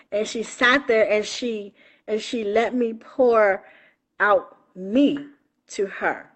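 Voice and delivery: ordinary talk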